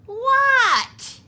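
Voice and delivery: high, loud yelled What; Froggy yelling back to mother. A narrator's reading. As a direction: yell back from outside. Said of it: "yelled Froggy".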